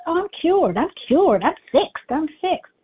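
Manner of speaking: ordinary talk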